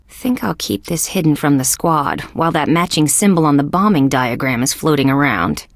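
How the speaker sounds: usual speaking voice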